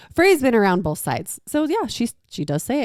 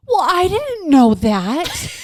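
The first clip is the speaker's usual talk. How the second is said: put-on child voice; 8 semitones higher, much slower than usual. Child voiced in mom's story.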